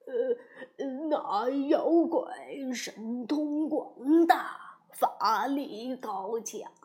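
a cowed mountain god pleading quietly; begging for mercy. A narrator's reading. Mountain god begs the Monkey King for mercy, timid and soft. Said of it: "山神连忙告饶说".

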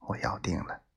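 breathy, unvoiced lover's whisper; possessive whisper. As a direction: whisper the claim close to her ear. Possessive vow, whispered close. (低声耳语般的占有宣言)

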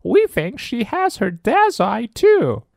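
very high, slow squeaky voice; high squeaky monster mum; monster parent voice. Acted. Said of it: "said Blob".

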